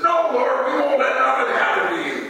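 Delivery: high, hurried protest; urgent, loyal refusal; protesting Peter. Acted. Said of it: "And Peter says".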